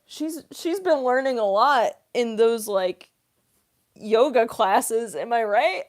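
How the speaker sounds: loud, hammed-up admiration with a laugh; mock oblivious husband. Streamer voicing a spy fooled by his wife, loud and amused. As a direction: play the clueless spy, loudly impressed.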